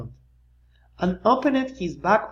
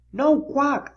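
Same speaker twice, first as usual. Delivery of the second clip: Splat's no quack; 8 semitones higher than usual. A narrator's reading. Splat reporting the silent duck, higher. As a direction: say it as Splat, raised. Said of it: "said Splat".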